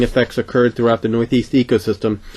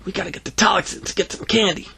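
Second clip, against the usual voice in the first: high, quick, lowered voice; urgent kid; 8 semitones higher, 4 dB quieter than usual. Acted. Boy tugging his friend away, high hurried voice. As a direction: hurry it along like a nervous boy.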